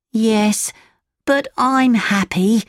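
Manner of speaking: high, slow donkey character; Lampwick, contented donkey. Acted.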